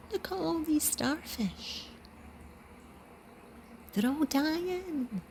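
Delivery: put-on high, slow excited wee boy voice; excited little boy. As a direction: squeak it out like an amazed little boy. Boy excitedly shouting about starfish.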